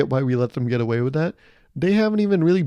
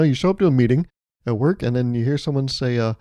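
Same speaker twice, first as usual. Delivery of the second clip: normal talking voice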